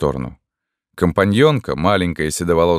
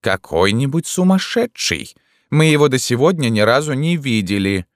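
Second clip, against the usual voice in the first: high, emphatic, carrying dismissal; deliberately loud, distinct; 8 semitones higher than usual. A narrator's reading. Companion answers before curious onlookers, loudly and distinctly. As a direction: say it clearly for onlookers to hear. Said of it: "сказала громко и умышленно внятно".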